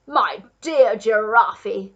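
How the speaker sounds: loud, drawn-out exclamation; hearty, slow outburst as a duke; crying out. A narrator's reading. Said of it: "cried the Duke".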